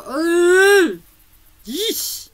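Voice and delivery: loud, high grossed-out groan; disgusted groan. Retold or imitated. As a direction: recoil with a loud yeesh. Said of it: "I'm go".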